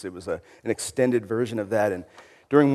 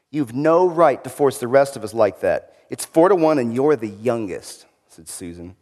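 story reading aloud; 3 semitones higher, 5 dB louder than usual. Acted. Reads Narnia passage aloud.

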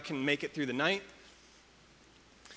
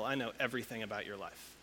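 God softly reassuring Israel, lower and softer; 4 semitones lower, 4 dB quieter than usual. Retold or imitated. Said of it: "the God who made heaven and earth says".

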